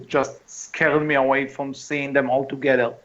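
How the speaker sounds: ordinary talk, nothing marked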